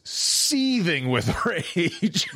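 breaking into laughter on the last word; slow it down and crack up; laughing. Real, not acted. Telling friends about the furious runner, laughing through it. Storyteller cracks up at own story.

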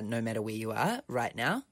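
ordinary delivery

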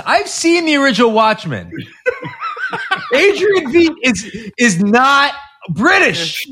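can barely get it out for laughing; real giggles breaking into the line. Real, not acted. Laughing at the Watchmen joke.

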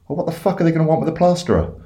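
high, quick, incredulous question; baffled punchline. Acted. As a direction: blurt it out in mock bewilderment. Baffled duck delivers the punchline.

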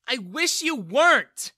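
high, slow, hurtful shout; Bella yelling. A narrator's reading. Daughter disowning her dad in a quarrel, yelling. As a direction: yell it back at him. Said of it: "Bella yelled at him".

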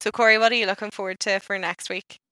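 ordinary talk

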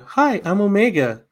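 put-on high, bright little girl voice; a cheerful young girl introducing herself; Omega impression. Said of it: "doing your Omega impression".

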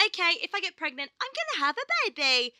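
high, cheery voice mocking the body's logic; naive body voice. Voicing her body turning thirty, high and mockingly cheery. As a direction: play the body as a chirpy simpleton.

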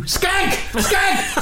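high laughing yelp; shouted blurt. Acted. Psychic blurts vision, laughing.

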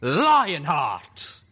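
huge booming monster shout; blasting answer. A narrator's reading. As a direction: bellow the name with blasting force. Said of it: "nearly blasting us back".

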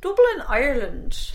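playful hint of Irish accent. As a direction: say it like a Dubliner, lightly. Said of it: "practising my Irish accent".

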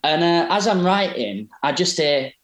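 normal talking voice

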